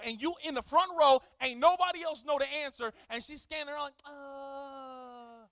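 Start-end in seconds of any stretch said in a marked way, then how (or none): 4.0-5.4 long drawn-out soft strained "uhhh"; straining "uh". Acted. Kid waiting as teacher scans the room, straining uh. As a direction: hold a softer, suspended whine.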